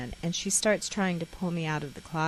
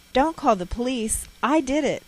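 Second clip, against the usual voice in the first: higher, louder blurted plea; raised, pleading child's confession; urgent confession; 7 semitones higher, 7 dB louder than usual. A narrator's reading. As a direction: blurt it out loudly to stop them. Child confesses to stop police call.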